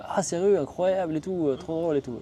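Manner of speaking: high, bright rush of mock amazement from the driver; amazed excitement. Driver raves over the grandfather story, excited. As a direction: gush over the story like it's the best news.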